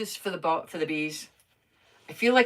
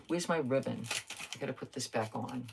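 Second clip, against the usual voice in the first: ordinary talk, nothing marked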